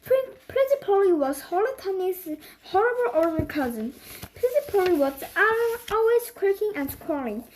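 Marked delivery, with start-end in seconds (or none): none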